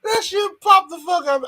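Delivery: high, wound-up exclamation; excited. Real, not acted. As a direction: get hyped about it.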